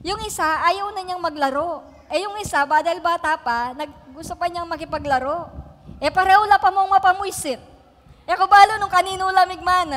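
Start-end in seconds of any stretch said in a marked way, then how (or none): 6.0-9.9 mock scolding. Mother scolding her fighting kids.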